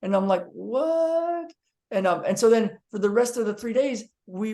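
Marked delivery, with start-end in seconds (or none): 0.5-1.5 high, stretched what; retold drawn-out what; astonished. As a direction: let the word hang in amazement. People falling around room.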